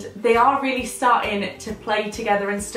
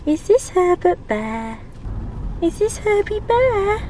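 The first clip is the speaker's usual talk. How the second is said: higher, slow cooing question; 8 semitones higher than usual. Real, not acted. Showing kitten his teddy.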